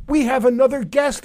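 ordinary talk

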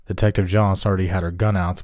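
ordinary delivery

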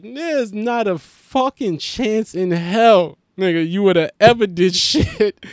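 loud, slow and scornful; mocking loud retort. Comedian scoffing back at the mixed kid, loud and slow. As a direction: slam the claim down slowly, scoffing. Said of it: "And I'm like".

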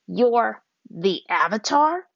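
slow, loud stunned question; can't believe the boy is the Avatar; shocked. A narrator's reading. Waterbender leader to Aang, slow and loudly shocked. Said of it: "The leader seemed shocked".

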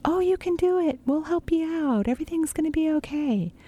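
high, gentle, reassuring encouragement; encouraging a child. Shown as a demonstration. Voicing what to tell children.

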